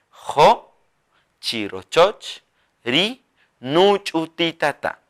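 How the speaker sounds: Jo, chirochoch, ri nuchutitata said apart and slow; slow broken dictation. Shown as a demonstration. Teacher dictates the sentence in pieces. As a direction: piece the phrase out slowly. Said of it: "Así".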